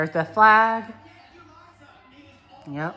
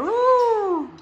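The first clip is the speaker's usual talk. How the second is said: excited cheer; 12 semitones higher than usual. Real, not acted. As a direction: cheer like the ball just dropped. New Year's Eve cheering.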